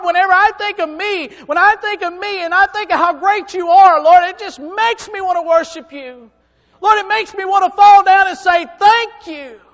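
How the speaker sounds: loud, high impassioned prayer; fervent prayer. Acted. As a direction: pour it out to God, overwhelmed. Preacher voicing his own prayer.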